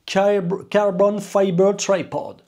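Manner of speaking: high, slow show-off English accent. Shown as a demonstration. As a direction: put on an over-the-top English accent. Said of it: "Quel accent de ouf". (accent anglais exagéré, aigu et traînant)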